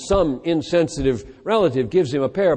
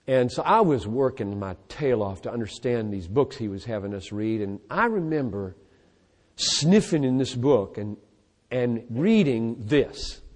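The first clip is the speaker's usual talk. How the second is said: ordinary delivery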